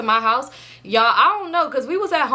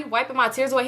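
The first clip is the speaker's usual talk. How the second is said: ordinary delivery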